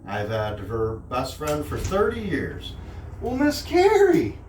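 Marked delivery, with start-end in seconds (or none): 3.2-4.4 high bright welcome; excited greeting. Real, not acted. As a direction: greet the guest with delight. Host greets guest at door.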